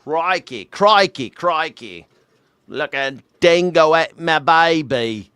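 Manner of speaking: broad mock Australian accent. Mocking an Australian newsman's origins.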